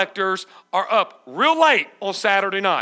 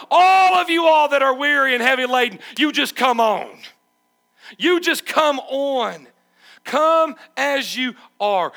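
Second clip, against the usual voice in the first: Jesus inviting; 5 semitones higher, 7 dB louder than usual. Retold or imitated. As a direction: call the weary home, loud but open-armed. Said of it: "Jesus says what".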